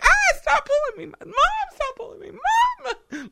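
very high squeaky complaining pup; whiny little dog voice. Retold or imitated. Tiny dog whining at her owner in a high squeak. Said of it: "you'll see her head like".

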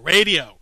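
Radio said loud and falling, scoffing; stressed word. Real, not acted. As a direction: hit Radio hard to make the point. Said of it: "question about a picture on a radio show".

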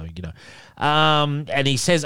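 usual speaking voice

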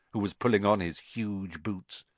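ordinary delivery

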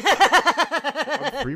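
laughing, high and loud. Real, not acted. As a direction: laugh through the line. Laughing while joking.

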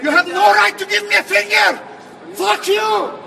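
ordinary delivery

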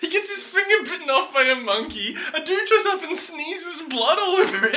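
high, laughing disbelief; reading his notes through laughter; laughing exasperation. Retold or imitated. Host reading out his own notes on the put-upon dad, laughing. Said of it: "This is the point where I've written down".

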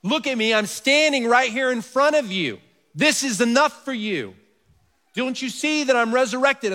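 a hint of an indignant Jesus scolding; indignant rebuke. Preacher imagining Jesus scolding Thomas, mildly indignant. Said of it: "He could say".

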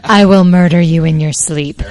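menacing mock threat, laughing; a playful creepy threat; scary voice. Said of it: "Scary voice".

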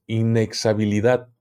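ordinary talk, nothing marked

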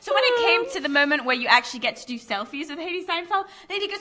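ordinary delivery

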